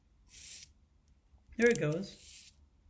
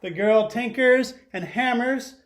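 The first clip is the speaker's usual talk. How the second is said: louder and higher, bright read-aloud voice for the class; storybook read-aloud; 4 semitones higher, 8 dB louder than usual. Acted. Teacher reading a picture book to her class, lively read-aloud. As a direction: read it big so the whole class hears.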